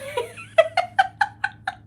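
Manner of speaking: high squealing burst of laughter; real laugh. Real, not acted. Host laughs remembering Bird Box, high squealing laughter. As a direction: crack up, pitch flying high. Laughs recalling intense movie.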